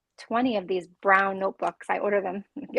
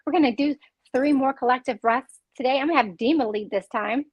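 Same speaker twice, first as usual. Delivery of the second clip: usual speaking voice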